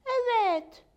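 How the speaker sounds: high, loud, stretched yes; proud. A narrator's reading. Maya confirms her splinter to Rex, proud. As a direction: announce it with pride, drawn out. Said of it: "dedi Maya gururla".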